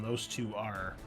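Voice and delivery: ordinary talk, nothing marked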